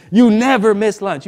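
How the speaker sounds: emphatic mock indignation; retold accusation. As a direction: imitate friends calling you out. Friends confront him, retold.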